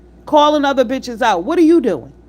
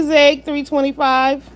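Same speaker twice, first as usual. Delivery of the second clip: ordinary talk, nothing marked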